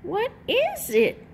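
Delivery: high, bright crowing rooster call; high little rooster voice for a picture book. A narrator's reading. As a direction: crow it out like a proud little rooster. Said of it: "crowed the little rooster".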